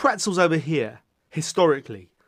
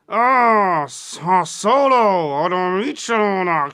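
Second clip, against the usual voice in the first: slow, booming Jabba voice, drawn out; Jabba the Hutt; 2 semitones higher, 4 dB louder, much slower than usual. Pretend. Playing Jabba to his camera, slow booming alien voice. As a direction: lean in as the giant slug, slow and gloating. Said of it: "Jabba the Hutt's just leaning in".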